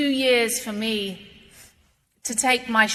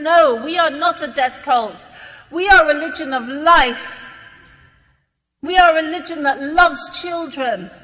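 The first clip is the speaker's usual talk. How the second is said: raised and louder, insisting on each phrase; fervent louder rebuttal, voice lifting; passionate declaration; 3 semitones higher, 7 dB louder than usual. Real, not acted. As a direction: proclaim it with conviction to the crowd. Insists Islam is not a death cult.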